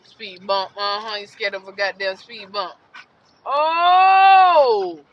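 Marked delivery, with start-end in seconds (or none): 3.4-5.0 loud high shout of alarm; sudden loud high yelp; loud cry. Real, not acted. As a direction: yelp out in shock. Dog climbs over.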